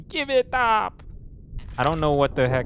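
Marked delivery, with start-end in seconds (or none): 0.1-1.0 high, hammed-up yell with an odd accent; mock sailor shout. Repeating Boomdog's cry for the viewers, high mock yell. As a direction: throw out the sailor's cry again. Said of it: "what accent that was".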